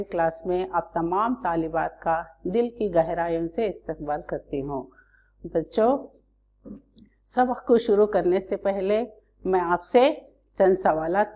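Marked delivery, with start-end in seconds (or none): none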